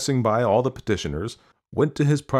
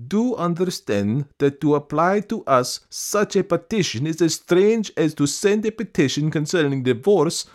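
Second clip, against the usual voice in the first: emphatic, raised-pitch explaining; exasperated official; 8 semitones higher, 3 dB louder than usual. A narrator's reading. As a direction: labour the point in exasperation.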